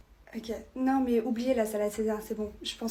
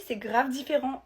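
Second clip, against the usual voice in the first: usual speaking voice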